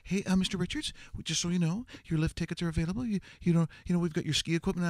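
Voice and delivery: high, hesitant polite staff voice; nervous hotel worker, fast and high; timid staff voice. Retold or imitated. Staff politely addressing Mister Richards.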